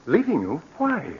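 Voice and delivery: shocked question. Acted. Brother hearing his sister will leave, startled and high. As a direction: jolt of disbelief.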